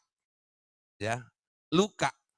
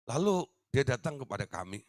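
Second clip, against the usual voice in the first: ordinary talk, nothing marked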